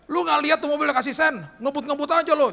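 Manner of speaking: loud, high-pitched angry yelling; street-side shouting in outrage; angry bystanders. Retold or imitated. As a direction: holler a scolding at a careless rider. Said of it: "orang-orang udah pada marah sama dia".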